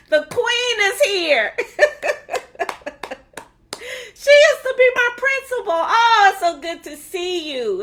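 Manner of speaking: high, loud exclaiming broken by laughter; delighted, laughing gush. Real, not acted. Host welcomes her former principal, delighted and laughing. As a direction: gush through real laughs.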